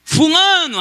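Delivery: high, loud call-out; cheering shout of a crowd of kids. Retold or imitated. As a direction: imitate kids yelling a name in welcome. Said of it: "os outros pivetes gritando assim".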